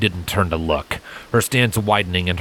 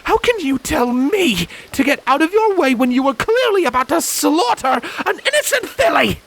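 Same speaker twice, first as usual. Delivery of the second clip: much higher, furious challenge; indignant demand; 3 dB louder than usual. A narrator's reading. Scarlet accusing Silent of killing a filly, high indignant outrage. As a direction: accuse him outright. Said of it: "Scarlet demanded".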